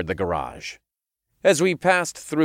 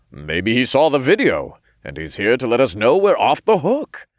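louder, higher hopeful suggestion; hopeful character voice; 4 semitones higher, 5 dB louder than usual. A narrator's reading. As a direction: pitch it up, offering a hopeful guess. Raph's hopeful line voiced in story.

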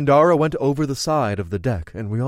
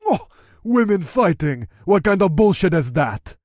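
louder, higher disgusted orc; 6 semitones higher, 6 dB louder than usual. Acted. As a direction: gag on it like a revolted orc. Same orc continues.

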